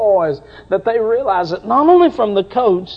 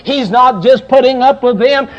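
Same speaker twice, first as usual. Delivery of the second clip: normal talking voice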